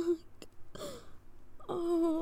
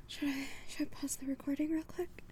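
whispering; 7 dB quieter than usual. Real, not acted. Asks quietly about pausing.

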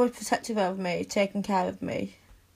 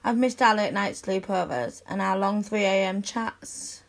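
normal talking voice

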